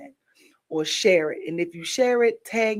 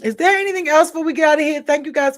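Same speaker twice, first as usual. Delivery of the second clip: perky rush; 8 semitones higher, 6 dB louder, faster than usual. Real, not acted. Wrapping up livestream, perking up.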